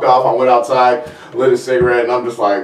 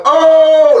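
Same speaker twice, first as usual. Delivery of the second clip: loud, high shocked cry; yelping as the feathers start flying; horrified yelp; 7 dB louder than usual. Real, not acted. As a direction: recoil at the sight. Hawk tears the bird apart.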